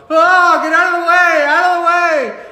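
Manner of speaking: high loud screaming crowd; panicked shouting. Acted. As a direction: yell in terror. People fleeing robot.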